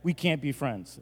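soft, deadpan verdict; quiet finality. Pretend. Pastor disowning his cereal-bungling buddy, quiet finality. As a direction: say it softly, as settled fact.

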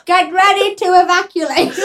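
mock-urgent, through laughter; laughing. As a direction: tease it, giggling. Teasing repeat while laughing.

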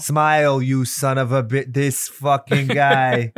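slow into a hammed-up New York accent. Co-host redoing Brody's corrected line, New York accent. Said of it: "I wish that was the way he talked".

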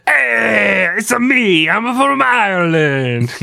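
high, loud mock-Italian voice; hammed-up, drawn-out Italian caricature; cartoon Italian accent. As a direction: belt it out in a silly Italian accent, stretching the end. Joke botched Irish, cartoon Italian voice.

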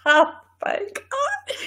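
high, slow words through helpless laughter; laughing too hard to speak; crying with laughter. Real, not acted. Cracking up at her co-host's grunted answer, laughing helplessly. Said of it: "laughing fit".

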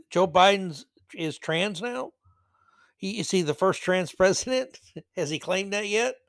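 ordinary talk

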